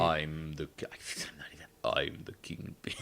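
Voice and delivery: low whispered Kingpin impression; lightly voiced menacing crime boss. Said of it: "my Kingpin is better than yours".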